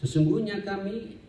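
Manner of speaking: ordinary talk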